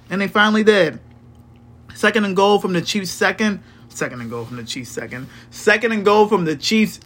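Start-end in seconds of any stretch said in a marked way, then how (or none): 3.9-5.3 much softer and faster, under the breath; quick muttered repeat. Real, not acted. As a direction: mutter it to yourself while checking. Rereads the stat line.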